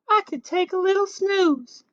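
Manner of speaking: put-on high, light little-girl musing; high drowsy girl voice; sleepy Goldilocks. A narrator's reading. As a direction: muse about a nap like a child. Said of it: "she said".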